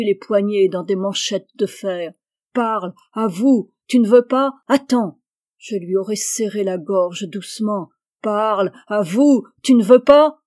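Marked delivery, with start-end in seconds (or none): 2.5-5.2 menacing interrogation. Acted. Jealous husband forcing his wife to confess, loud menacing demand. 8.2-10.4 much louder, rising to a shout on pas; furious demand. Acted. Husband choking his wife to make her talk, furious shouted demand. Imagined interrogation while strangling.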